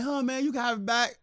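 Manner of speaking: lightly voiced sheepish thief; thief offering. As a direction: offer it back meekly. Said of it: "he's like".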